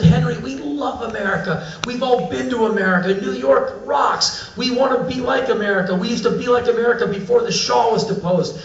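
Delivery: high, gushing mimicry; excited group. Iranian dinner guests tell visitor they love America, excitedly. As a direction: imitate eager locals all wanting to speak. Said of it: "they all started talking at once, arms gesticulating wildly".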